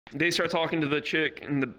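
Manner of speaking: ordinary talk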